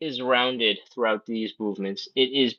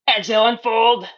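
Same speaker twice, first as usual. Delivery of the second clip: much higher, tight and effortful, with a whooping release; straining voice; 9 semitones higher than usual. Real, not acted. Instructor at the deepest stretch, straining and whooping. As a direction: squeeze it out at the bottom of the fold. Deepest fold, last round.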